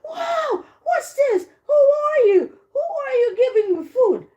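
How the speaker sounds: very high, acted outburst of surprise; surprised grandma. Grandma sees the wolf at her door, high-pitched surprise. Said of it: "the grandma was surprised".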